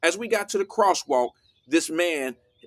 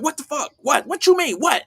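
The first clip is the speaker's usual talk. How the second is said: pitched way up, mock outraged what the fuck; mock angry yelling; 9 semitones higher, 5 dB louder than usual. Homeless man snapping at the daughter, imitated angry outburst. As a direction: replay a stranger's angry outburst, voice jumping high.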